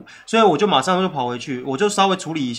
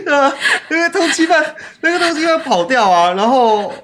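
high, loud, laughing through the words; can barely get it out for laughing; laughing retell; 8 semitones higher, 6 dB louder than usual. Real, not acted. Friend laughing hard while retelling the fugitive story, high and loud.

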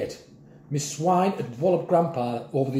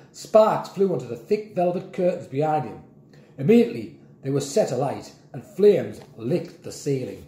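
ordinary delivery, nothing marked

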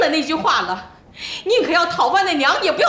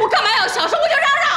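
defiant yell; 8 semitones higher, faster than usual. Acted. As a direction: shout it to spite him. Refuses to keep quiet.

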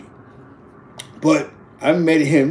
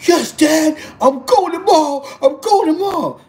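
high, loud mock girl voice; eager daughter; 13 semitones higher, 5 dB louder than usual. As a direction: imitate a daughter promising dad excitedly. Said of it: "She was like".

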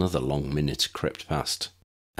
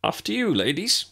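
higher, showy politeness with a grin; grinning gallant prefect; 11 semitones higher than usual. A narrator's reading. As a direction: play the mock gentleman, lightly. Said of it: "Eric grinned".

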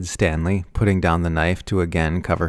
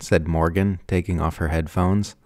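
plain reading voice

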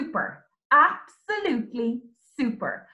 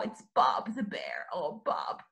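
hushed, much lower secret whisper; 11 semitones lower, 7 dB quieter than usual. Acted. Teacher reveals Bob the bear to the children in a whisper. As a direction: let them in on a secret.

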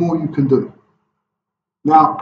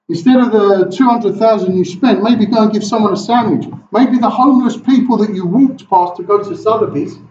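higher and louder, building rebuke; fiery preaching; 6 semitones higher, 4 dB louder than usual. Acted. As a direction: drive the point home to the rich. Preaching at full force.

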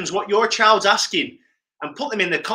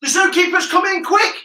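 high urgent call to hurry; excited warning; 11 semitones higher than usual. Pretend. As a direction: warn the kids to hide fast, playfully urgent. Game warning called out.

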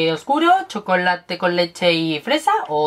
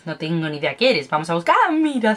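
normal talking voice

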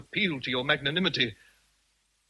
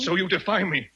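indignant challenge; 7 semitones higher, 6 dB louder than usual. Acted. Rochester confronts Jane.

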